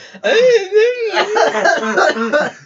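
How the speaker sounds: high wheezing laugh into a cough; raspy coughing laugh. Real, not acted. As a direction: cackle till it turns to hacking. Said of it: "You sound like a chain smoker".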